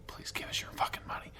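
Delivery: hushed, sly whisper of a greedy preacher; mock whisper. Mocking a church that begs for money, whispered. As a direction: whisper it like a con man's secret plea.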